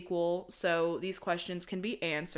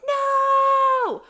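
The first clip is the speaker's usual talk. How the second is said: a high, much louder scream of "No!"; Epps screaming; 10 dB louder than usual. Retold or imitated. Retelling the final scene, Epps screaming "No!" in a high, loud shriek. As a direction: shriek it like the heroine at the end. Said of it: "ends with Epps screaming".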